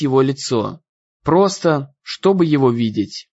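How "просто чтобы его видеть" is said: plain reading voice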